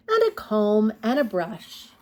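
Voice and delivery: ordinary delivery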